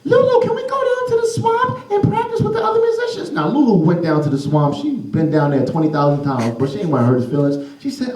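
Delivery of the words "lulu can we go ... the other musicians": put-on very high child asking; high boy voice; eager kid. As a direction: beg to go practice. Boy asks Lulu.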